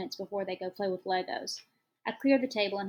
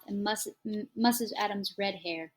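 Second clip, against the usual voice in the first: usual speaking voice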